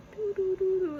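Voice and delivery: loud, high mock ghost-music humming; spooky music hum. Vlogger mimics the podcast's ghost music, mock eerie hum. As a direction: hum the eerie ghost cue like a haunted radio. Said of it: "there's, like, this".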